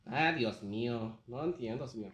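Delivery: hammed-up Mexican accent in Spanish. Voice actor stays in character, Mexican accent. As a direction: exclaim in a playful Mexican accent. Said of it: "to do a Mexican accent".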